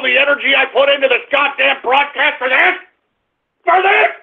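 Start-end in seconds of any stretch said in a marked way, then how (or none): 3.6-4.1 high incredulous yell into a roar; outraged outburst. Real, not acted. Host rages over wasted effort on his broadcast, yelling. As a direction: explode in frustration.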